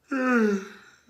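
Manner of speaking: long, loud, high yawn; a big late-night yawn. Real, not acted. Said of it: "deal with that yawn".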